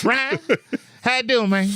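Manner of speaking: How you doing, man up high and friendly; high mock greeting. Chuck greeting the listener in a high playful voice. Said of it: "greeting in high voice".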